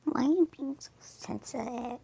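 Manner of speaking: breathy, mocking whisper of a mean girl; a teasing whispered taunt. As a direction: sneer it under your breath, playfully. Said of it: "would you never do that".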